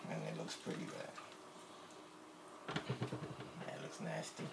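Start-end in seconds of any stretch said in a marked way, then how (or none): none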